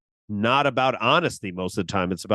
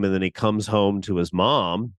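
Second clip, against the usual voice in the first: ordinary talk, nothing marked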